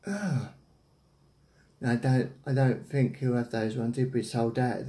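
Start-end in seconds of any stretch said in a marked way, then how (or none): none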